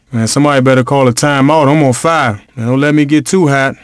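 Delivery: ordinary talk, nothing marked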